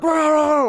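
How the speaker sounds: high, frantic Chihuahua yapping; dog barking imitation. Imitating a friend's Chihuahua at the door, high yapping. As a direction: imitate a little dog going wild. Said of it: "it'd go like".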